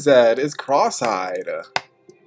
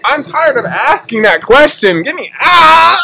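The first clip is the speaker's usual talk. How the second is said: higher, louder, fed-up yelling; angry rant; 11 semitones higher, 8 dB louder than usual. Real, not acted. Gamer abandoned by team, furious yelling. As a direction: blow up at the team, end on a cry. Ranting, ends in shout.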